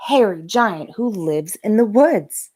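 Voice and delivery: ordinary delivery, nothing marked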